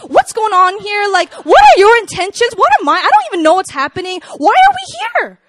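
worried questions building to high, loud outburst; freaking out. Retold or imitated. Woman replays confronting her date over dinner, freaking out. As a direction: escalate into a hammed-up frantic squeal. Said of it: "I'm freaking out".

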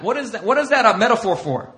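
ordinary talk, nothing marked

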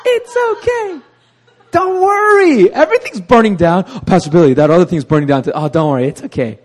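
sing-song calm while everything burns; overly cheery optimist. Retold or imitated. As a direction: pastor brushes off disaster to Pastor Billy, high and cheery. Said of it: "I'm like".

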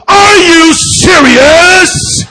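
very high, drawn-out mock shout; hammed-up incredulous yell; Brother Artwell. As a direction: stretch every word in a loud holler. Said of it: "he goes".

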